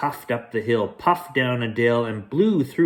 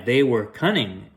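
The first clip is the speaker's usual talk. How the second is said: usual speaking voice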